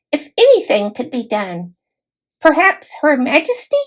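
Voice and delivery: high squeaky voice of a dignified little mouse; a tiny knight, formal and put out; proud mouse knight. A narrator's reading.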